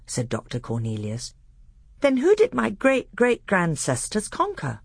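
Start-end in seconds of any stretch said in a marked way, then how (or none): 2.0-4.8 put-on high, puzzled young prince; young pupil. A narrator's reading. Caspian questioning his tutor about his ancestors, puzzled and high.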